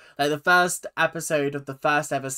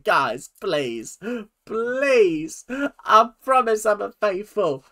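mock pleading; 6 semitones higher, 4 dB louder, slower than usual. Acts out pleading at the table.

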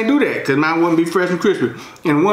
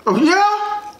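very high squeaky mock interest; high falsetto surprise; impressed caller. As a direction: play an amazed friend on the phone, pitched way up. Voices an impressed phone caller.

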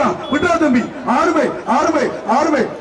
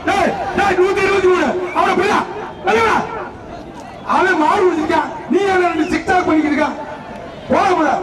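through a megaphone; 5 semitones higher than usual. Real, not acted. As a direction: bark orders at the arena crowd. Shouting at tamers over loudspeaker. (ஒலிபெருக்கியில் உச்சக் குரலில் கத்துதல்)

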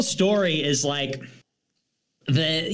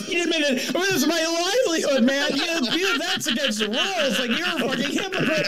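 whiny mock panic; 9 semitones higher, faster than usual. Mocking panicked streamer.

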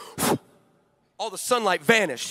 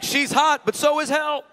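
mock holy pun; 6 semitones higher, 4 dB louder, slower than usual. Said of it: "you're like".

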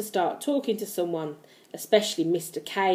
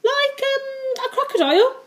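musing, pitched way up; thoughtful. A narrator's reading. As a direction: think aloud in a high voice. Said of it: "sucking his pencil thoughtfully".

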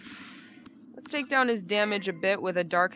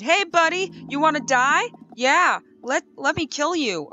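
taunt; 6 semitones higher, 4 dB louder than usual. Pretend. Taunting a game enemy.

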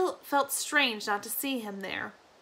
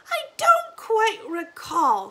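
put-on slow, high, vague old woman; old granny voice; 10 semitones higher, 5 dB louder, slower than usual. Granny explaining to Lina, slow and high. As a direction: drift off like a confused grandmother.

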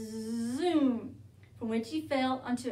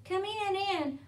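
squeaky echo; 8 semitones higher, 4 dB louder than usual. Acted. Sugar Glider echoes high.

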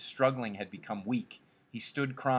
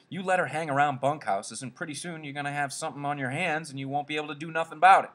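higher, fast scolding voice; scolding ranch hand Carlson; 5 semitones higher, 5 dB louder, faster than usual. Acted. Carlson warns Curly about his wife, scolding. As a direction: warn him off, pitch up and rushing.

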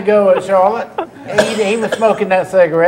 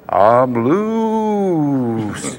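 voice on the radio; 4 semitones lower than usual. Retold or imitated. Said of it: "And I heard this".

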